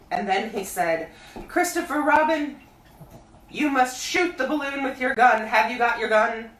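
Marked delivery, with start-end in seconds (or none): 1.5-6.5 high, loud bear calling down; Pooh voice. A narrator's reading. Pooh calling down to Christopher Robin, high bear voice. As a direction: call up from the honey tree like a bear.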